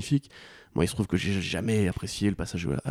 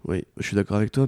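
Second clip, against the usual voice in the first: ordinary talk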